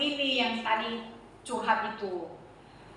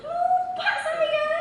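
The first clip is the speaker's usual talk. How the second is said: very slow, high, laughing confession; guilty confession; much slower than usual. Retold or imitated. Preacher confessing to the congregation he forgot to pray, slowly and high. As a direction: admit it slowly, half-laughing in shame. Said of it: "saya benar-benar hancur hati saya".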